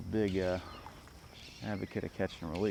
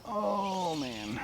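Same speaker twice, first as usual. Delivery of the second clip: high, sliding moan of regret; dismayed moan; 10 semitones higher than usual. Real, not acted. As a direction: groan it out from up high. Still upset about lost fish.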